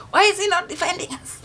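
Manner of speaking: frantic and high; panicked child. Retold or imitated. Child wondering why dad won't defend them, frantic. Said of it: "I'm like".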